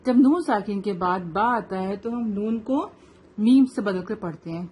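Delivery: ordinary talk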